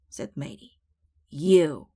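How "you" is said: loud shouted "You". A narrator's reading. Captain shouts at Matey in a squabble, loud. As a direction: shout it back at him. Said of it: "shouted Captain".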